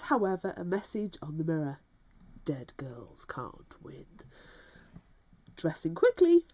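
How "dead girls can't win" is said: slow, low, chilling whisper; creepy whisper. Pretend. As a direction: whisper it like a ghost's threat. Creepy message read aloud.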